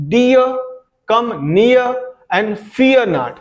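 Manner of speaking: "Dear", "near" and "fear" with the ee vowel pressed hard; teacher demonstrating a stressed ee in "Dear", "near", "fear"; stress on EE sound. Shown as a demonstration. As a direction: lean on the ee in "Dear", "near", "fear", pausing after each phrase. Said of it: "ई sound पर हम ज्यादा जोर दे रहे हैं".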